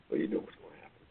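hushed aside. Acted. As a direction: drop to a whisper. Hushed aside after questions.

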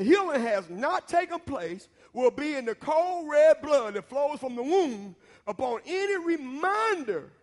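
loud, high and slow, stretching the big words; fiery preacher's climax; preaching at full force. Acted. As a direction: preach it down with rising fire. Preacher drives home the lesson.

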